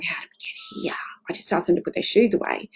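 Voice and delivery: hushed, baffled whisper to yourself; bewildered whisper. Retold or imitated. Parent whispering in disbelief after a fight over shoes. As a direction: whisper it like a parent lost mid-argument.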